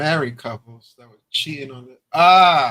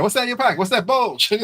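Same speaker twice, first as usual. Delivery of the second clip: high, louder mock alarm; mock surprised question; 7 semitones higher, 4 dB louder than usual. Someone spotting hidden red flags, mock alarm. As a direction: gasp at it like you caught something. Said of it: "You're like".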